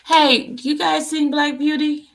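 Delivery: loud, high question to the group; calling out. Real, not acted. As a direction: call out to get everyone's attention. Calls out to viewers about someone missing.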